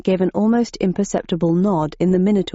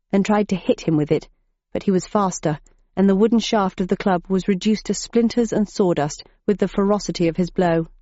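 plain reading voice

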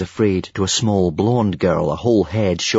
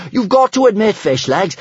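high, thrilled shout over the wind; high-pitched, elated holler; whooping; 14 semitones higher, 4 dB louder than usual. A narrator's reading. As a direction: call out in sheer exhilaration. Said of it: "whooped Kamikaze above the roaring".

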